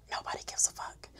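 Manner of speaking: hushed stage whisper for emphasis. Acted. Whispering the point to college viewers. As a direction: lean in and whisper it like a secret.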